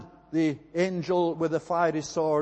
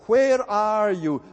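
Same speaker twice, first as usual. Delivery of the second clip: higher, louder, slow call of God searching for man; 4 semitones higher, 5 dB louder, slower than usual. Acted. God calls into the garden for hiding man, slow, raised call. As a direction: call out slowly, like God seeking someone hidden.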